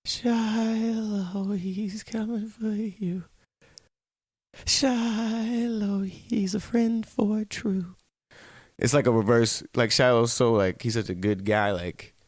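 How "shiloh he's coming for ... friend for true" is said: high, slow eerie horror-movie chant; sing-song like a ghost child in a hallway; creepy horror kid. Pretend. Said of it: "every horror movie with a little kid".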